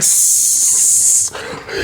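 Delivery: long hissed "sss"; sizzling electric hiss; imitated taser sound. Imitates tasing himself.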